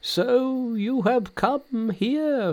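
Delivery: very high, long drawn-out greeting; old priest's cry. Acted. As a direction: cry out high and slow in recognition. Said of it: "on seeing Monkey he cried".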